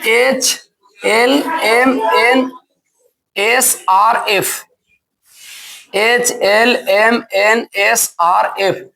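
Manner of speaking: very slowly, letter by letter; slow spelling. Shown as a demonstration. Teacher listing the letters for students, slow spelling. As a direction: dictate each letter separately.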